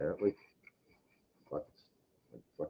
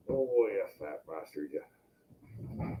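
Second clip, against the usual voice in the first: slow soft fond murmur; affectionate to cat; 5 semitones lower, 11 dB quieter, much slower than usual. Real, not acted. Greeting his big cat fondly, slow and soft. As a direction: fuss over the cat slowly.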